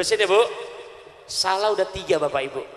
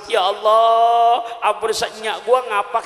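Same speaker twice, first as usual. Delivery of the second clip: hammed-up answering wail; 6 semitones higher, 6 dB louder than usual. Preacher jokingly echoes the grandmother's prayer, loud hammed-up shout. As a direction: belt the prayer back as a joking echo. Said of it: "Coba habis ngapa kita sambut".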